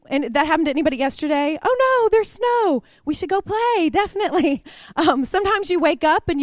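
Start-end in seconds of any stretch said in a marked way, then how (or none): 1.6-4.6 put-on excited kids. Kids excited about snow.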